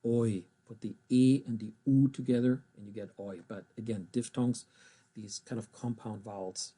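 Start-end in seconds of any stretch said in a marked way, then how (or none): none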